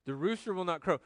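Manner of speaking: ordinary delivery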